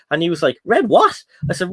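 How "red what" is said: imitated barman, pitched up in disbelief; mock-incredulous question, much higher; baffled barman. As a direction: query it like you've never heard of such a thing. Retold baffled barman's reply.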